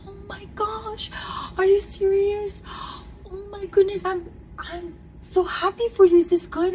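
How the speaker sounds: high, hushed retold gushing; high-pitched, overcome joy for someone else; tearfully happy nurse. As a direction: imitate a nurse moved to tears. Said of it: "She starts crying too with me".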